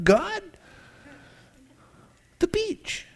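high, pleading whine about the beach; whiny plea. Pretend. Pastor mocking himself begging God, high whiny plea. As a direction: beg like a worn-out child.

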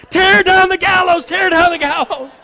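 high, very loud mock panic; frantic shout. Haman texting his kids in terror, frantically shouting. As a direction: yell a desperate order, hammed up.

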